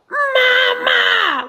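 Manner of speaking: put-on Mama shouted high and loud like a baby; mock baby scream. Mom imitates her son screaming for her. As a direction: belt Mama out like a demanding baby. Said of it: "And he goes".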